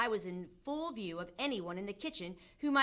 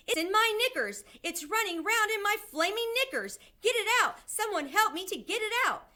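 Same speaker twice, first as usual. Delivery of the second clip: high, loud frantic shrieking; panicked screaming; 13 semitones higher, 7 dB louder than usual. A narrator's reading. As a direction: beg for help at full pitch. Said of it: "the man was screaming".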